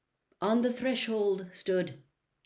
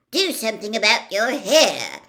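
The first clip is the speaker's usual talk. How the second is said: sharp, louder aunt snapping an order; snapped; 4 semitones higher, 10 dB louder than usual. Acted. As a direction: bite the order out at him. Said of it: "Aunt Petunia snapped".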